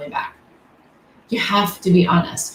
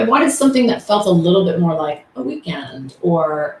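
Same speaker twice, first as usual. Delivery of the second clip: normal talking voice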